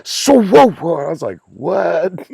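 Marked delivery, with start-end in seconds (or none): 0.0-1.1 high, loud whoa of surprise; mock whoa. Imitating Lou sliding in. 1.5-2.3 startled what. Retold or imitated. His own reaction retold.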